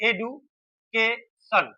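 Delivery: Education in three parts, "edu... ke... shon", with pauses; split into syllables. Shown as a demonstration. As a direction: break education slowly into three separate beats. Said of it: "কবার বলতে হচ্ছে এটা?".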